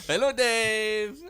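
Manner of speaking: high vampire greeting. Pretend. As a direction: play a vampire. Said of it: "to kind of like a vampire".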